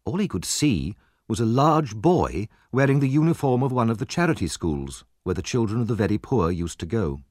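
ordinary delivery, nothing marked

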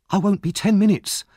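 put-on high, loud, eager boy; eagerly. A narrator's reading. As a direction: promise eagerly, keen to be off. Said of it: "replied Oliver eagerly".

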